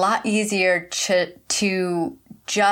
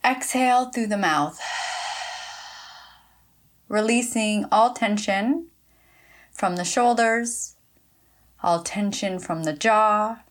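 unhurried instructions with long pauses; measured meditation voice, leaving room to breathe; guided meditation. Acted. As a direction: guide listeners gently into relaxation, phrase by phrase. Leads listeners in short meditation.

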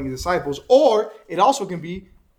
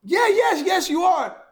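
very high, acted insistence; insistent accusation; 12 semitones higher, 3 dB louder, slower than usual. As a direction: press the accusation in a pitched-up voice. Accuser insists against Peter's denial.

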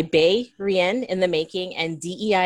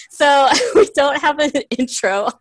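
laughing; 8 semitones higher, 7 dB louder than usual. Real, not acted. Laughing through intro.

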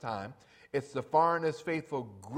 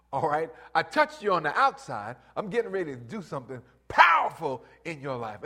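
preaching loud; 2 semitones higher, 8 dB louder than usual. Acted. Jesus voiced preaching.

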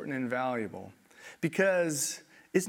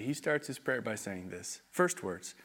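ordinary delivery

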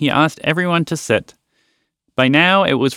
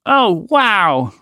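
exclaiming; 7 semitones higher than usual. A narrator's reading. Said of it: "Roger exclaimed".

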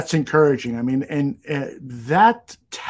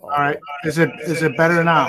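echoing; 4 dB louder, faster than usual. Real, not acted. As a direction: echo on the line. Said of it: "No, it's worse".

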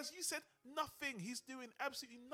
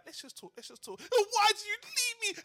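shrill, much higher, louder neurotic wail; Woody Allen whining; 14 semitones higher, 12 dB louder than usual. Retold or imitated. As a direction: whine like a needy neurotic lover. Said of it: "Woody Allen is going".

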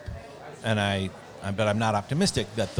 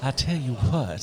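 mock Southern accent; 3 dB quieter, slower than usual. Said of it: "I have such accent envy".

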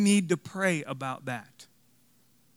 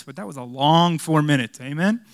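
ordinary delivery

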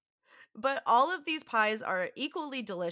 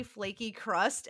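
normal talking voice

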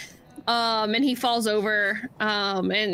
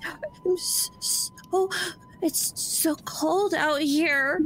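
high, shivering, slow little creature voice; distressed gnome voice; 6 semitones higher, much slower than usual. Acted. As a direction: plead through the cold, shivering. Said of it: "sounds very distressed".

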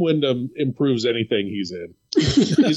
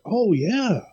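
high, mock-awed kid; hammed-up dawning understanding; kid's realization; 7 semitones higher than usual. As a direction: play a child suddenly getting it. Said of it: "when you're a kid".

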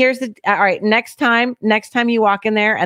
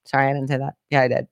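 normal talking voice

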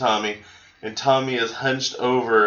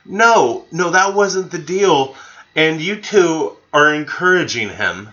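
high, loud, slow no no; mock scolding; 6 semitones higher, 5 dB louder, slower than usual. Retelling Jay scolding the guests, high and loud. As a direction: scold them like a betrayed buddy. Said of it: "And he's like".

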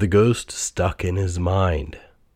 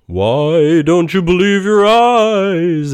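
loud, high, slow spectral voice; booming ghost; 10 semitones higher, 7 dB louder, slower than usual. A narrator's reading. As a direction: boom it out like a phantom. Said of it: "asked the ghost".

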